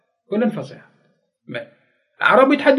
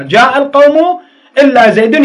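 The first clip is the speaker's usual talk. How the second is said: example called out louder and higher, rising at the end; emphatic example; 6 semitones higher, 6 dB louder than usual. Shown as a demonstration. Teacher giving pupils an example, loud and rising. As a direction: sing out the example for the class. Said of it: "teacher demonstrates example sentence".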